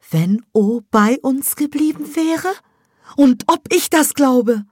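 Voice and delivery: high, loud, slow indignant mother. Acted. Mother answers Sevda, high and indignant. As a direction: hurl the question back.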